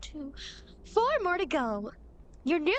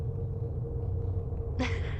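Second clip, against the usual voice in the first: sheepish airy laugh. Acted. Guide after admitting help.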